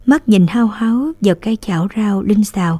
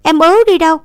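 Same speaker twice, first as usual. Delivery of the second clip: higher, louder pouty no; sulky refusal; 8 semitones higher, 6 dB louder, faster than usual. A narrator's reading. Little sister refusing to borrow rice, sulky. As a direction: refuse like a spoilt child. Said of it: "Nương ngúng nguẩy".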